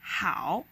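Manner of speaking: "Hao" pressed down, then bounced up; third tone. Shown as a demonstration. Teacher explaining the hard third tone, "Hao" dipping then rising. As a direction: dip your pitch on "Hao" and come back up. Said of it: "press your voice down a little bit".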